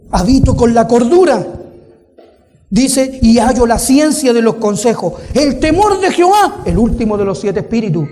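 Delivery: forceful preaching. Real, not acted. Preacher reading scripture on wisdom to the congregation, forceful. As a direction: drive each phrase home to the congregation.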